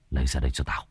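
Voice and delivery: "Lấy ra đây cho tao!" hissed low and quiet; low hissed threat. Acted. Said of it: "mà rít lên". (rít khẽ đầy đe dọa)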